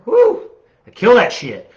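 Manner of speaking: a high, loud whoop of delight; excited whoop. Real, not acted. A winter lover cheering about the cold season, loud and high.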